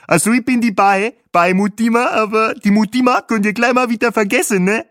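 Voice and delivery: high, loud African tour guide voice; chirpy guide with a foreign lilt; Bahe's voice. A narrator's reading. Tour guide greets his passenger, high and chirpy.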